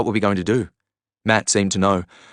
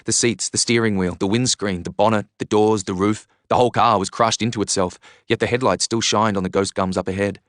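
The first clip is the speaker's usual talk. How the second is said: ordinary delivery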